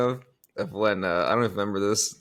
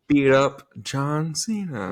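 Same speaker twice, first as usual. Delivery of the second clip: slow, drawn-out put-on tough guy; mock wrestler voice; 3 semitones higher, much slower than usual. Friend mocking Cena, slow hammy tough-guy voice.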